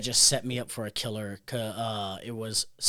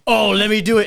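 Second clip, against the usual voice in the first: eager, loud and high; 7 semitones higher, 12 dB louder than usual. Retold or imitated. Retelling jumping in eagerly.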